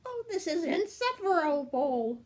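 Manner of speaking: squirming worm. Acted. The dragon-turned-worm complains, high and whiny. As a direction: whine like a tiny offended worm. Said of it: "squirmed the worm".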